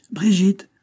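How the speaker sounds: high, loud call of the name; child's eager shout. A narrator's reading. A boy shouting for the housekeeper with happy news. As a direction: call her from across the house. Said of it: "l'entendit-on s'écrier".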